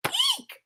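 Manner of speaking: tiny high frightened squeak. Acted. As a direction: squeak in terror like a bat. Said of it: "squeaked the bat".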